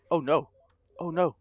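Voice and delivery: normal talking voice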